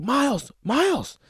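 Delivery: high, drawn-out call for a friend; mock calling out to someone. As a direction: call out a name, stretched and high.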